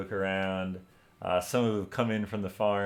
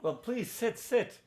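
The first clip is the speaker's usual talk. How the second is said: Torn's warm welcome; 8 semitones higher than usual. Acted. Torn welcomes friends, old times.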